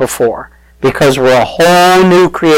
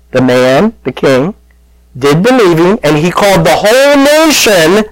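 ordinary talk

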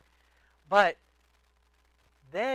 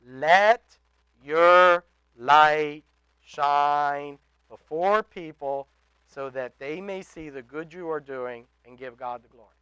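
slow scripture reading; 2 semitones lower, 10 dB louder, slower than usual. Acted.

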